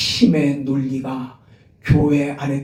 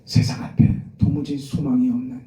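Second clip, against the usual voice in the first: ordinary delivery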